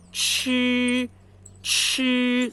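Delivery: chi bem lento e mais alto, língua dobrada, soltando ar; retroflex aspirated chi. Shown as a demonstration. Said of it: "minha língua tá dobrada e que eu tenho que soltar ar". (chi retroflexo aspirado, lento)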